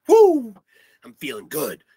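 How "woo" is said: high, loud rock whoop; sudden frontman's high "woo". Real, not acted.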